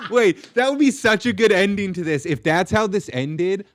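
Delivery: thrilled by the idea, voice shooting up; real laughing excitement; excited squeal. Real, not acted.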